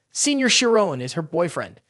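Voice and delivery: ordinary delivery, nothing marked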